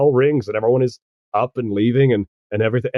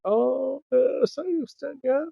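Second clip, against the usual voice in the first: high, soft gossipy whispering; hushed, high-pitched background chatter; girls whispering; 13 semitones higher, 7 dB quieter, slower than usual. Acted. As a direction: whisper behind someone's back. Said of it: "They're whispering".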